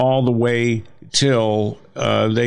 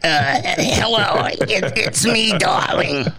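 higher, slow, raspy smoker's voice; smoker's rasp; 9 semitones higher, slower than usual. Retold or imitated. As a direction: croak it like a lifelong chain smoker. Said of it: "sounded like I was cough up a lung".

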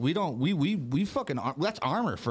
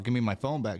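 ordinary talk, nothing marked

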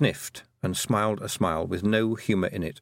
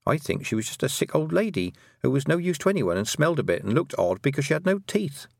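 put-on higher-pitched girl character, explaining; earnest young Tiffany; 7 semitones higher than usual. Girl explaining the Baron's son's disappearance, earnest. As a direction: voice an earnest girl telling what happened.

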